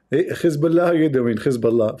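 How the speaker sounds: Hezbollah with a throaty kh, teasing; mock Hebrew kh. Shown as a demonstration. Greeting Hezbollah the Israeli way, throaty kh. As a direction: rasp the h in Hezbollah, mocking.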